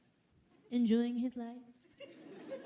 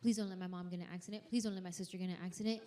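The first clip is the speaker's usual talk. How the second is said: quick, hushed, rattled-off prayer; rushed prayer; 5 semitones lower, 8 dB quieter, much faster than usual. Retold or imitated. Praying in the car for every family member, fast and hushed. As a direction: rattle off prayers fast under your breath. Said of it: "I say".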